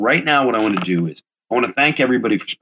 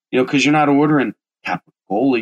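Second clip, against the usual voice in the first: normal talking voice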